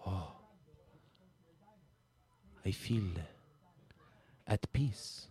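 fading to a soft, low, peaceful murmur; blissful hush. Acted. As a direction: drift off into mock serenity. Tastes candy, becomes blissful.

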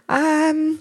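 high, unsure little hum; hesitant child. Retold or imitated. Mother imitating her son's reluctant hesitation. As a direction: hesitate like a shy boy.

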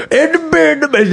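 high, mumbled comic celebrity impression; Cosby. Retelling a friend debuting his accent at a party, high hammed-up impression. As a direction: do an exaggerated high-pitched comedian impression. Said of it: "an amazing Cosby accent".

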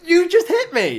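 high, loud cry of outrage; shocked protest. Real, not acted. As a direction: protest as if just slapped by your co-host. Real or staged slap, protests.

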